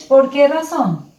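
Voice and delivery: ordinary talk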